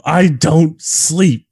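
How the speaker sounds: very slow, high, loud line reading; overdone B-movie villain delivery; hammed-up movie quote. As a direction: stretch out each word theatrically. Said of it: "Remember that when he's like".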